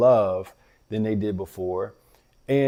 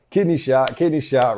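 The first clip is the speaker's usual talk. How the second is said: punchy, louder call of kidney shot, kidney shot; playful call; 4 semitones higher, 6 dB louder than usual. Retold or imitated. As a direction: call it out like roughhousing with the boys. Dad's playful line repeated by kids.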